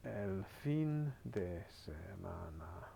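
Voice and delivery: very slow, careful Spanish, each syllable clear; slow careful teacher demo; slow model reading. Shown as a demonstration. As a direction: spell it out slowly for a learner. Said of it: "Let's go with last weekend pasado".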